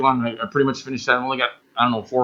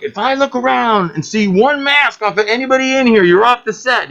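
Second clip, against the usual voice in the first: imitating a strict director; 9 semitones higher than usual. Rock video director warning the crew about masks, bossy and raised. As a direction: bark it like a set director laying down rules. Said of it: "the director was like".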